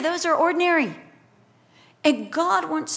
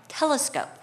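ordinary talk, nothing marked

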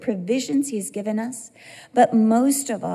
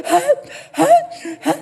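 high gasps that could pass for fright; re-enacted breathless wheezes of laughter; helpless laughing gasps; 11 semitones higher, 4 dB louder than usual. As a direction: imitate gasping with silent laughter. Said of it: "I'm laughing so hard, he thinks I'm scared".

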